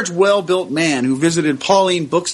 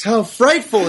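exclaiming; 4 semitones higher, slower than usual. Acted. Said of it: "exclaimed Sister Marie James".

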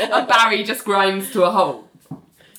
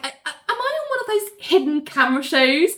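flustered Richard voice; 8 semitones higher, much faster than usual. Retold or imitated.

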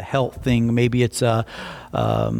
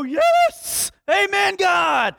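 ecstatic yell; much slower than usual. Retold or imitated. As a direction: leap up shouting thanks to God. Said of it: "And you go".

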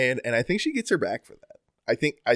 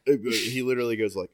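normal talking voice

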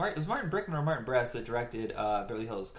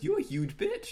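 mock insult. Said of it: "He goes".

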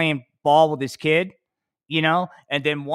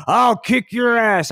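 tough-guy threat; 8 semitones higher, 5 dB louder, slower than usual. Retold or imitated. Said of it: "I'd have go".

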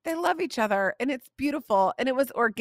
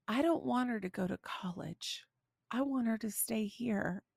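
much softer retold children's plea; 11 dB quieter than usual. Both kids at dinner about their sister, soft pleading. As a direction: hush it, like little ones quietly pleading.

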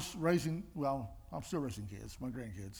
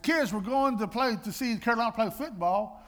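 excited announcement; 7 semitones higher, 7 dB louder than usual. Retold or imitated. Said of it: "And I said".

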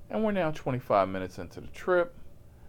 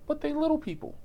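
high mock voice; faster than usual. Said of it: "passenger voiced".

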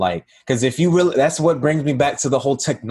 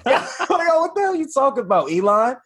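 high, fast, laughing disbelief; mock outrage at Elon, cracking up; 13 semitones higher than usual. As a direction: squeal it out through a laugh. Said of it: "It's like".

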